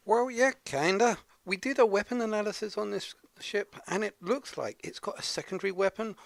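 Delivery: put-on a self-admittedly weird Scottish accent. Sidekick briefing on alien weapon analysis, mock Scottish accent. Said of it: "so I just gotta put on this weird Scottish accent".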